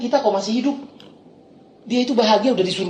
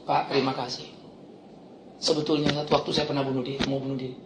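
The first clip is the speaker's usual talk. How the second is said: hushed grateful confession, much softer; quiet confession; 9 semitones lower, 11 dB quieter than usual. Retold or imitated. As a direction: murmur the confession low and soft. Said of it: "satu dokter datang sama saya". (pengakuan lirih dan pelan)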